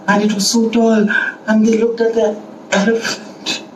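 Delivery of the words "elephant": hushed, near-whispered storytelling reveal; breathy little gasp of a word; hushed awe. A narrator's reading. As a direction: drop to an awed hush on the word. Awed ants look up at elephant.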